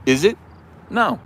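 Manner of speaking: normal talking voice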